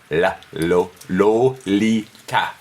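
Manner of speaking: "La, lo, lo, li, ta" said slowly, syllable by syllable; each syllable of "La, lo, lo, li, ta" placed deliberately; syllables spelled out. Shown as a demonstration. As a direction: tap out "La, lo, lo, li, ta" with the tongue stepping forward. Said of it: "by saying".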